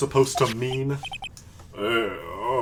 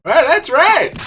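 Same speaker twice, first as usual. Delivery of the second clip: startled shout; 11 semitones higher, 7 dB louder, slower than usual. Acted. Judge startled.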